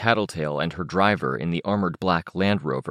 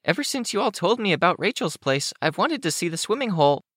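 put-on very high, childlike character voice; eager young Kenzie; 13 semitones higher, faster than usual. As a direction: pitch it way up, wistful and eager.

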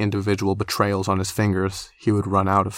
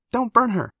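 put-on girlish voice; 4 dB louder than usual. A narrator's reading. Young girl voice in story.

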